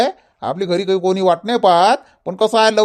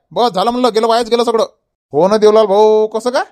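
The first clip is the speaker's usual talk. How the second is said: ordinary talk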